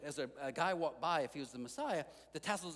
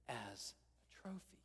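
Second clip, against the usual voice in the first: hushed whisper; 4 semitones lower, 12 dB quieter, slower than usual. Acted. Jesus telling the healed man, whispered softly. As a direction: breathe the words as a soft whisper.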